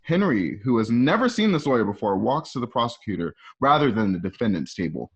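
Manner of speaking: ordinary talk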